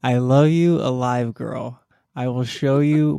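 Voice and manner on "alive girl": ordinary talk, nothing marked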